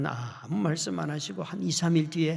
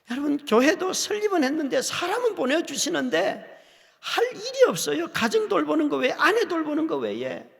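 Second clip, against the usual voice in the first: raised, higher complaint; pitched-up sermon lament; 11 semitones higher, 4 dB louder than usual. Real, not acted. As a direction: voice rises in frustration. Preacher lamenting his empty years.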